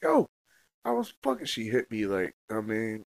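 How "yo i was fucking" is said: retold outburst. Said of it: "I was like".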